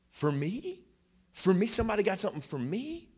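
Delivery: high, astonished questions in a retold voice; amazed disbelief. Man told of a present, imitated in amazed disbelief. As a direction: act out someone stunned to get a gift.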